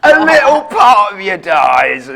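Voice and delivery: loud, high, slow mock despair; mock anguish. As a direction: wail it like a tragedy. Mock tragic punchline of walkout story.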